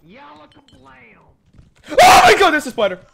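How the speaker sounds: startled high scream; screaming at a spider. Real, not acted. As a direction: shriek in fright, drawn out. Sees a spider in game.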